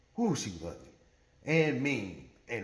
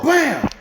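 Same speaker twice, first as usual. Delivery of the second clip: loud, high burst for a slammed door; sudden punchy sound effect; imitated door slam; 8 semitones higher, 7 dB louder than usual.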